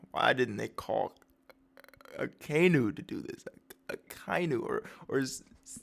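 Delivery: soft, halting mock complaint; weary grumble. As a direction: grumble like a worn-out employee. Kizaru hates his job, complains.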